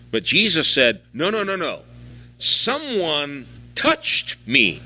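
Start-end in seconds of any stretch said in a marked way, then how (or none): 1.1-4.8 touched slow and pressed, someone touched me drawn out; emphasis on touched. Retold or imitated. Jesus insisting to Peter, slow stress on touched. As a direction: lean hard on the word touched. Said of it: "Special emphasis on that word, touched".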